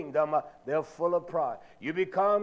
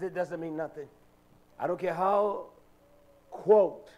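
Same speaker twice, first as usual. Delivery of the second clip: usual speaking voice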